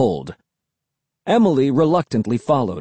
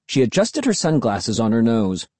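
ordinary delivery, nothing marked

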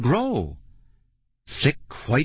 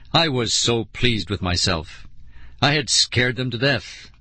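ordinary delivery

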